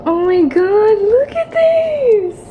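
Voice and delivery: high, loud drawn-out squeal; thrilled amazement. Real, not acted. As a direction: stretch it out high in amazed delight. First sight of her hotel room.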